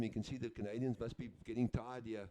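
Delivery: normal talking voice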